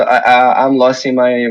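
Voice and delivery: usual speaking voice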